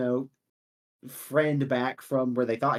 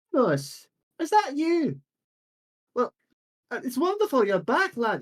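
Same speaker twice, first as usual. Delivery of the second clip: put-on old villager voice; 9 semitones higher than usual. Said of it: "be like".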